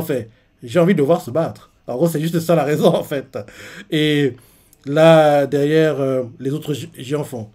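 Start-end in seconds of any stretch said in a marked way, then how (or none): none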